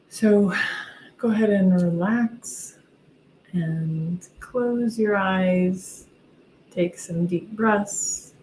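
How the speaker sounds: soft, slow guided meditation voice; right in your ear. Acted. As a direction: ease them into calm, gently and slowly. Leads a guided visualization.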